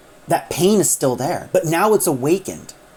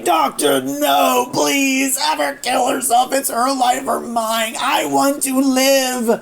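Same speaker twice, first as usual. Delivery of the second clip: screaming; 9 semitones higher, slower than usual. Retold or imitated. A dying house spirit begs the Doctor, screaming. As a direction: shriek the plea as if your life hangs on it. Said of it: "starts screaming".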